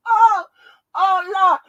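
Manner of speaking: high, loud wail after a laughing fit; recovering from laughter. Real, not acted.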